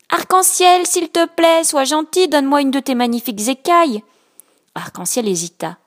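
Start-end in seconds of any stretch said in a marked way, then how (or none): 0.1-4.0 high, loud little-fish plea; small fish asking nicely, high; pleading little fish. Acted. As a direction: beg sweetly for one scale. Little blue fish pleads again.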